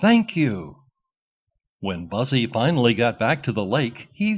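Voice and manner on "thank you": loud, high burst of thanks; a duckling thanking the king with joy; grateful quack. A narrator's reading. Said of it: "Buzzy quacked".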